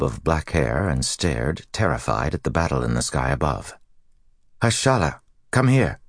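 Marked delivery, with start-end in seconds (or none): 4.6-6.0 higher, calling across to her. Acted. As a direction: call her over. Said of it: "Swan called".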